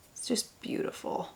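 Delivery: barely-voiced whisper of admiration; soft hushed whisper; admiring whisper. Real, not acted. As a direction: breathe it out in awe. Admiring highlighter.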